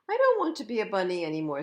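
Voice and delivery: loud, fast complaint; protesting. A narrator's reading. PJ telling everyone he quits being a bunny, fast and loud. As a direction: blurt it out in one rush. Bunny whining he won't stay.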